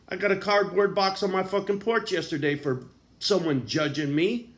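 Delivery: usual speaking voice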